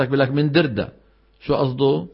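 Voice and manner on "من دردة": "دردة" answered the local way, all d; jim as dal. Retold or imitated. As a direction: turn Girga into "دردة". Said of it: "أهلها بنطقوا الجيم دال".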